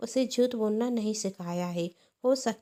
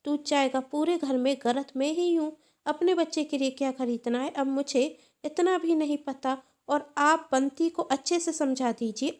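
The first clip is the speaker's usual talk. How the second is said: put-on aunt yelling at the boy hiding behind his mother; angry shouting; 2 semitones higher, 4 dB louder than usual. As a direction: shout her down over the broken toy. Aunt keeps ranting angrily.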